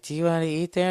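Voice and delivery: high mock girlfriend voice, rising; coy question in a put-on girl voice. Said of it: "So she's like".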